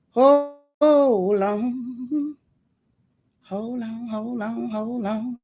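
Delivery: very slow, stretched hold on; drawn out. Pretend. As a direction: stretch each word out. Stretched hold on over music.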